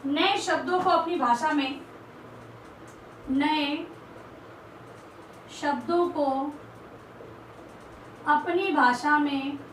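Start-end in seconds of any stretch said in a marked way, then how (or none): 3.3-9.6 slow, word by word with long pauses; slow dictation. Shown as a demonstration. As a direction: dictate each word for students to write down. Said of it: "teacher dictating word by word". (धीरे-धीरे, एक-एक शब्द रुककर बोलना)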